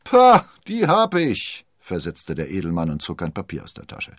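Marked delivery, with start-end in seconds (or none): 0.0-1.6 high, loud, drawn-out scoff; lordly, slow retort called out; scornful shout. A narrator's reading. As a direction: dismiss it haughtily at full volume. Haughty nobleman calls back to captain.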